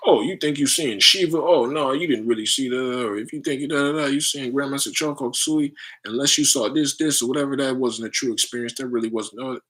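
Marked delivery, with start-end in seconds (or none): 0.1-4.1 higher, louder, sneering gatekeeper voice; mocking disciple. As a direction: scoff like a know-it-all follower. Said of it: "their disciples might say something".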